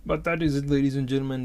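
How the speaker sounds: grand sign-off to the audience; big announcer sign-off. Acted. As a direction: boom it out like a showman closing the act. Wrapping up a rant to viewers.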